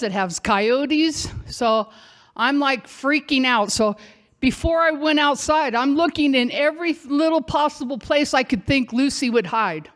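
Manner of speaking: usual speaking voice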